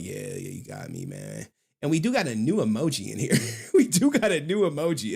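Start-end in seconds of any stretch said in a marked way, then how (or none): 0.0-1.5 a low, sheepish mock reply; low, sheepish voice of a caught-out viewer; lower, softer mock admission. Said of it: "You're like".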